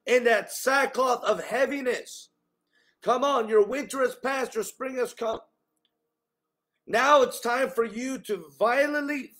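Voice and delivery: impassioned sermon delivery, pitch lifted; forceful preaching. Real, not acted. Preacher urging the congregation to throw off heaviness, forceful preaching. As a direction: drive each phrase home to the congregation.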